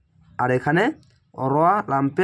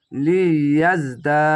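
লিয়াজদা very slow with the long vowel stretched; slow tajweed reading of লিয়াজদা; slow drawn-out recitation; much slower than usual. Shown as a demonstration. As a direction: chant it slowly, lengthening the alif. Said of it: "এক আলিফ টেনে পড়বেন".

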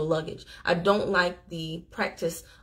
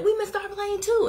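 higher excited quote; 12 semitones higher than usual. Retold or imitated. Telling him they missed their plane too, high and excited. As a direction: squeal it up much higher, delighted. Retelling their own reply.

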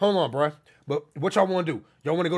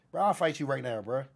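ordinary delivery

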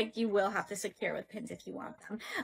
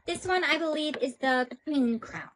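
normal talking voice

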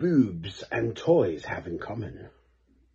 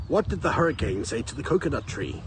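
usual speaking voice

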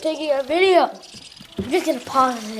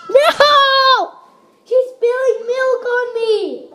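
shrieking; 9 semitones higher, 7 dB louder than usual. Real, not acted. Sibling spilled milk on her.

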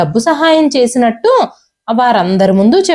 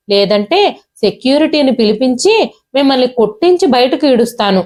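plain reading voice

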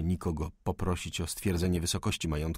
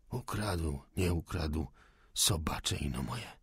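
softer, lower grumble, slow at the end; muttering; 4 semitones lower, 6 dB quieter, slower than usual. A narrator's reading. Old cook muttering at the fire, sullen. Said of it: "pokrzykiwał".

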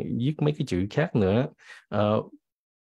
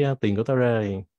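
usual speaking voice

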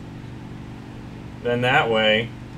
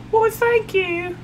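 very high, slow dainty thanks; lady voice; slower than usual. Pretend. The co-pilot replying to the compliment as a high lady. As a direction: answer like a prim lady, drawn out. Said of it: "My co-pilot talks like a lady".

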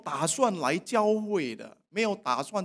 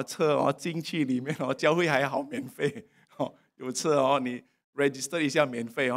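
normal talking voice

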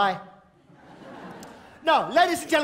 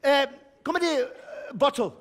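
normal talking voice

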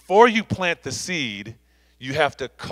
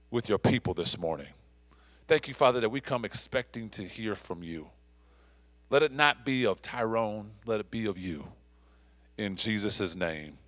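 soft, lowered praying voice; prayer; 4 semitones lower, 6 dB quieter than usual. Real, not acted. Pastor thanking the Father for his word, softly prayed. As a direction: pray quietly and reverently, phrases falling away.

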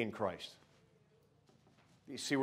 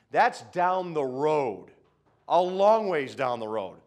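slow, loud, stretched phrase; preacher driving the point home slowly; drawn-out emphasis; 3 semitones higher, 9 dB louder, slower than usual. Real, not acted. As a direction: lean hard on every word of it.

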